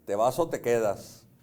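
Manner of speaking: half-joking tough-guy ultimatum; macho Mexican man. Retold or imitated. Husband mock-challenges his wife as a Jalisco macho, lightly voiced. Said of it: "bien macho mexicano de Jalisco".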